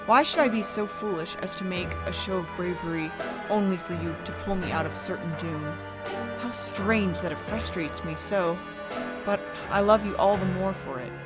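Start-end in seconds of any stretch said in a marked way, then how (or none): none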